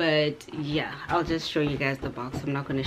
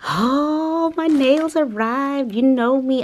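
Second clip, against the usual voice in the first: much higher and louder, slow and showy; dramatic excitement; 9 semitones higher, 8 dB louder, slower than usual. Real, not acted. Nails just arrived, thrilled dramatic squeal to viewers. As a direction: squeal with delight over the package. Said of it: "I'm dramatic and whatever".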